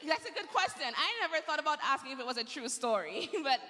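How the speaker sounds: laughing through the words, voice up high. Real, not acted. Child asks if story true.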